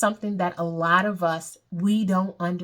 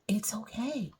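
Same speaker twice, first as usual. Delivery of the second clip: a gentle reassuring whisper; whispered reassurance; soft whisper; 3 semitones higher, 8 dB quieter than usual. Acted. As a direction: breathe it out softly to comfort them. Comforting listener about tears.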